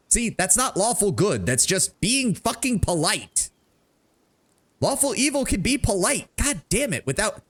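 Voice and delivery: high, worked-up protest; exasperated outburst about alignment; exasperated rant. Real, not acted. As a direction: rant at the character's choice.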